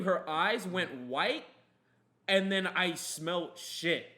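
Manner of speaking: loud excited punchline; excited climax. Real, not acted. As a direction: hit the payoff hard. Climax with laughter.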